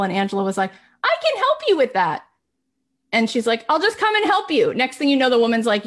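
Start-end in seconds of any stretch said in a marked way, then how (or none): 1.0-2.2 sudden high bright pitch, mock eager; eager high offer. Angela offering the stressed paralegal help, high and eager. 3.7-4.7 sudden high bright pitch, mock eager; eager high offer. Angela offering the stressed paralegal help, high and eager.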